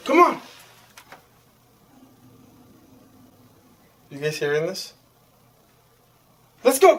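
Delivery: high, sing-song dog talk; coaxing a dog. Real, not acted. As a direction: coax the pup out, lilting.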